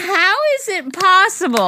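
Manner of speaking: high incredulous squeal. Retold or imitated. To her friend about the husband, mock disbelief.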